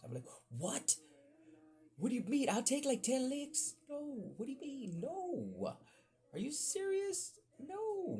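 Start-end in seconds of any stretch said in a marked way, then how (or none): 0.6-3.7 high, loud retold pleading; panicked protest. Kid begging the principal for ten licks, panicked protest. 3.9-8.2 very high, drawn-out protest; panicked disbelief. Retold or imitated. Kid told the principal will call mom, panicked disbelief.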